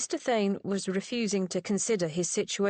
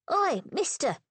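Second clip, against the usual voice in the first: lightly voiced cheeky little boy; a hint of a chirpy street lad; small boy piping up, lightly voiced; piped-up boy voice; 8 semitones higher than usual. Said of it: "piped up the little boy".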